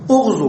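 اغزو begun with u, "ughzu"; damma on the hamza. Shown as a demonstration. Teacher showing the command of ghaza, اغزو begun with u. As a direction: say اغزو as "ughzu", short u first. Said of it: "بالضم لأن الزاي مضمومة".

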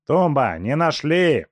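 high, loud call from the bushes. Acted. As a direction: call back from the search. Разбойники зовут издалека. (громкий оклик)

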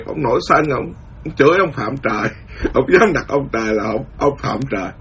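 usual speaking voice

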